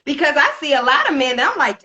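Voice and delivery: ordinary talk, nothing marked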